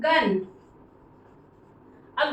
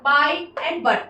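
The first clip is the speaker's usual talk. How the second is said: slow example words; 3 dB louder, much slower than usual. Shown as a demonstration. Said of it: "बी से ब".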